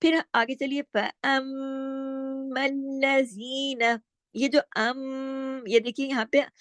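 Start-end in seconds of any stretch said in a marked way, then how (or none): 0.8-4.0 फअम्मल्लज़ीना with a long hummed mm; sustained monotone Quran-lesson recitation; full ghunnah. Shown as a demonstration. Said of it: "भरपूर गुन्ने के साथ इदगाम". 4.8-5.6 अम stretched into a long humming m; isolated, sustained nasal अम; nasal ghunnah. Shown as a demonstration. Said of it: "भरपूर गुन्ने के साथ".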